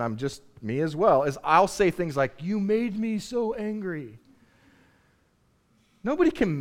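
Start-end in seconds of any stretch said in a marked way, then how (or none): 2.4-4.2 higher, drawn-out blame; mock accusation. Preacher quoting himself blaming another, higher mock accusation. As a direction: act out blaming someone, slowly and higher. Acts out blaming someone else.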